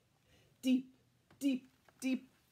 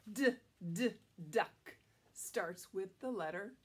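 usual speaking voice

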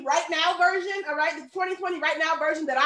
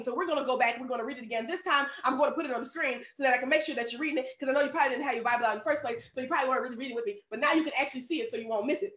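usual speaking voice

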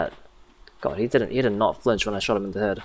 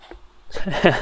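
laughing; 7 semitones higher, 4 dB louder than usual. Real, not acted. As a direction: burst of real laughter. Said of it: "I'm sorry, I got, it's always cracks me up".

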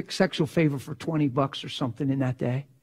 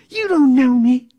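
higher, louder, slow insistence; a pastor's slow loud plea to the girl; raised slow plea; 9 semitones higher, 7 dB louder than usual. Retold or imitated. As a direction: drag each word out pleading. Passionate plea in quarrel.

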